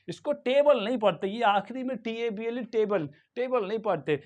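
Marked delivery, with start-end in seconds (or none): none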